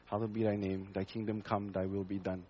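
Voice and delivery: hushed devotional reading; quiet recited prayer. Shown as a demonstration. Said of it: "we pray".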